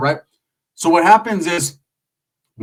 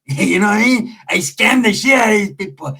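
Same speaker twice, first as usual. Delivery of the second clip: higher, lightly put-on voice; acted bragging cousin; 6 semitones higher, 3 dB louder than usual. Cousin boasting about scams, in an imitated higher voice.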